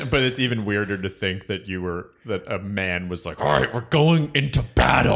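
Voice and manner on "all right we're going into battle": loud, raised rallying cry, hammed up; mock battle speech. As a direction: play a coach rousing troops, pitched high. Mock coach rallying kids for battle.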